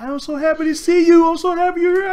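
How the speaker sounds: high, bubbly imitation of a happy woman; excited woman. Imitating the fan greeting him so happy, high and bubbly. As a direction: squeal it like she's thrilled to meet him. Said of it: "And she like".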